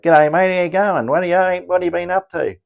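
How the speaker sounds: put-on high, loud nasal Aussie accent; hammed-up nasal Australian greeting. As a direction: talk broad Australian through the nose. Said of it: "that's how some Australians talk".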